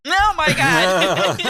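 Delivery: laughing in disbelief. Real, not acted. Player cracking up at her friend's creepy child, laughing.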